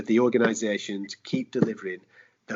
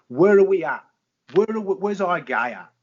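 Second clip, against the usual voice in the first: rhetorical demand; 7 semitones higher, 6 dB louder than usual. Real, not acted.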